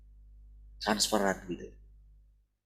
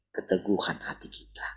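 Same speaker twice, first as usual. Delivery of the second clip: whisper; 4 semitones lower, 7 dB quieter than usual. Real, not acted. Preacher drops to whisper.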